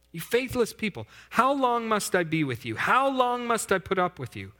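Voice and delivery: high, exasperated rebuke; frustrated rebuke. Acted. Preacher voicing a frustrated Jesus, pitched high. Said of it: "Jesus is frustrated".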